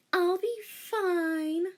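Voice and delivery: high, slow, calm; brave reassurance. A narrator's reading. Rei calming her worried teacher, brave.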